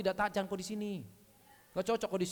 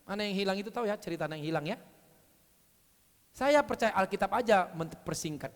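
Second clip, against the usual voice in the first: ordinary delivery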